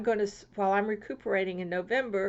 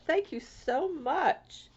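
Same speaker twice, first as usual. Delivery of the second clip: gushing thanks; 7 semitones higher, much slower than usual. Real, not acted. Thanking sender of the card.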